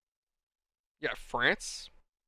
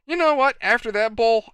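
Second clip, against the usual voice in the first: emphatic reply; 6 semitones higher, 8 dB louder than usual. Retold or imitated. Retelling his own loud reply.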